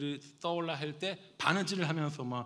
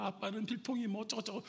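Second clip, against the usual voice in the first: high, softish tearful child imitation; play-acted weepy complaint; mock crying; 7 semitones higher, 4 dB quieter than usual. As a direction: whimper like a scolded daughter. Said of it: "또 울어요".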